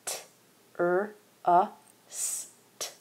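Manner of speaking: T, er, uh, s, t as separate sounds, last ones unvoiced; sounding out trust. Shown as a demonstration. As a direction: break "trust" into single sounds. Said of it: "The second syllable is trust".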